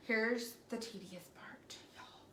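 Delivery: hushed whisper to the viewers. Real, not acted. Painter warning viewers about the fiddly part, whispering. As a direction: whisper it like a secret before the hard bit.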